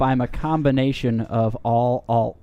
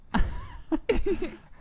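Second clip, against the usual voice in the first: put-on kid giggling; 6 dB quieter than usual. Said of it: "impression of a child's laughter".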